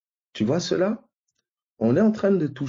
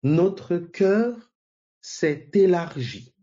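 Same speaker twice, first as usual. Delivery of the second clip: notre cœur raised high on cœur, then élargi drawn out slowly; slow emphatic reading; 5 semitones higher, much slower than usual. Shown as a demonstration. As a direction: lecture appuyée et lente, « cœur » monte, « élargi » traîne. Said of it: "Vous voyez un peu?". (insiste lentement sur « élargi » pour que tous voient)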